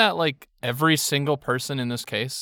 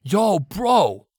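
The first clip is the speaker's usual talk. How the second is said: bro voice; 6 semitones higher than usual. Pretend.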